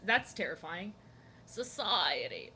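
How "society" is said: slow, low Joker delivery; Joker impression. Streamer imitating the Joker for her chat, slow villain voice. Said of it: "the Joker's voice".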